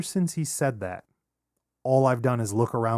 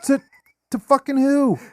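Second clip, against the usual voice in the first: loud, high-pitched disbelief; incredulous outburst; 7 dB louder than usual. Real, not acted. Challenging a friend who says they're selling, loudly incredulous.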